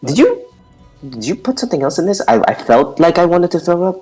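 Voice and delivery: high, quick suspicious question; surprised suspicion. Acted. Reacts to potion taste.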